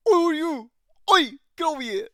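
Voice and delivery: high, loud cartoon dragon shout; hammed-up dragon bellow; voicing Vhagar. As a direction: belt it out like a cheeky giant dragon. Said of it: "He's like".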